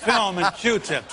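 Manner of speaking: very slow, laughing repeat; slow chuckling echo. Real, not acted. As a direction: savor the punchline, laughing.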